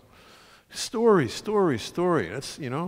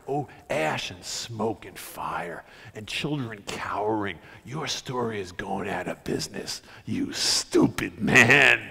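grim condemnation; 3 dB quieter, slower than usual. Acted. Speaker telling bin Laden his story is finished, grim condemnation. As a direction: let the pitch drop as you condemn, slowly. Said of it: "That's what I would say to him".

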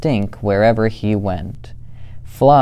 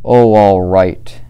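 sighing give-in; 6 dB louder than usual. Acted. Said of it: "sighed a big sigh".

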